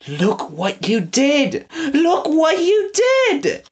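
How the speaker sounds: drawn out through sobs; sobbing accusation. A narrator's reading. Prisoner blames Eggs, sobbing slowly. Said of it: "he kept repeating".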